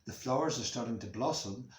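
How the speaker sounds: usual speaking voice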